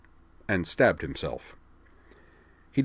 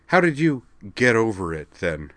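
sarcastic; 4 semitones higher, 4 dB louder than usual. A narrator's reading. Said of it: "asked Liza sarcastically".

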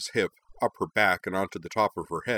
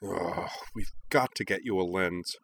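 softer, grumbling exasperation; annoyed grumble; 4 dB quieter than usual. A narrator's reading. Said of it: "grumbly noise of annoyance".

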